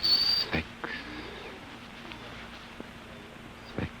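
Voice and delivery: sleepy whisper. Acted. Falls asleep under drugs.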